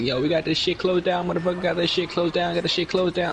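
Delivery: high, hyped rapper brag; rapper imitation. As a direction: boast it like a rapper on video. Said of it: "He's like".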